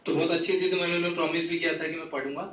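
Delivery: usual speaking voice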